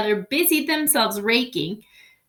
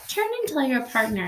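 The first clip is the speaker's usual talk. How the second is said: ordinary delivery, nothing marked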